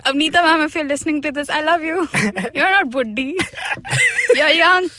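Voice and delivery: grovel cheerfully to the principal; laughing apology. Real, not acted. Guest laughs a hasty apology to her old principal.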